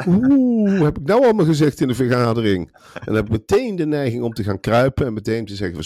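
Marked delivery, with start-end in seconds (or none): 0.1-2.7 high-pitched mock panic, louder on the opening oeh; geschrokken reactie. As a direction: play a cowed underling suddenly alarmed. Speelt geschrokken ondergeschikte na. (gespeeld geschrokken, met hoge stem)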